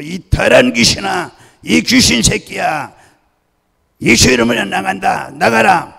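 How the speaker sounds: high, very loud command; imitating a pastor's full-force yell; roaring exorcism shout. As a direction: bellow to cast out a demon. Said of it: "이렇게 고함을 치".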